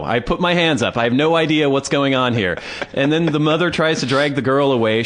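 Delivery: normal talking voice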